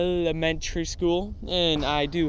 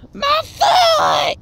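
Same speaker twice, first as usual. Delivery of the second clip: shrill shriek of pain, much higher and louder; pained shout; 7 dB louder than usual. Retold or imitated. Teacher shrieking at the kid on her foot, high pained yell. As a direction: yell it like someone just crushed your toes. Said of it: "until she goes".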